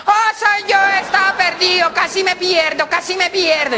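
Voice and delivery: high, squealing little-pig voice; piglet voice. Acted. Piglet greets owner at the door after getting lost, piglet voice.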